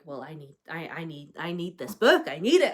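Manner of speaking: usual speaking voice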